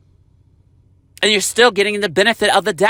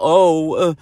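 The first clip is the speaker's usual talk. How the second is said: mocking quote. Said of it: "you're sitting up here talking about".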